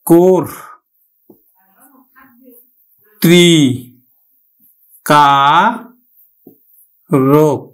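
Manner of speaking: কোর ত্রি কা রোক each syllable apart with long pauses. Shown as a demonstration. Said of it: "উচ্চারণটা হবে কিন্তু".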